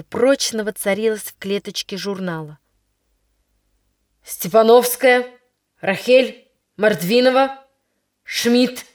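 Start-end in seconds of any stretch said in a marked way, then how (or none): 4.2-8.8 angry. Acted. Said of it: "сердито вызывал девочек Яковлев".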